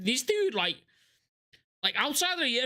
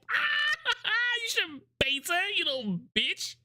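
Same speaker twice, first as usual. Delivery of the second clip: mock gloating laugh; 13 semitones higher than usual. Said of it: "get up out of the hospital bed and go".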